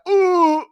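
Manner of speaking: high, loud awkward "ooh"; alarmed ooh. Retold or imitated. Babysitter hearing kid's rude remark, mock alarm. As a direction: gasp out a high, embarrassed ooh. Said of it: "And I went".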